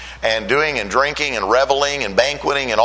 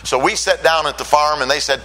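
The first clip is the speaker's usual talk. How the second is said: ordinary talk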